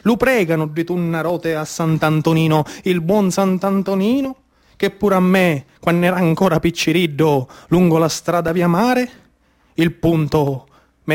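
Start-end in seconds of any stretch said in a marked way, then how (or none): none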